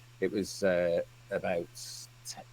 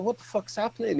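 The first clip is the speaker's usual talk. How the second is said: alarmed; 11 semitones higher than usual. Retold or imitated. Retold alarm at blokes.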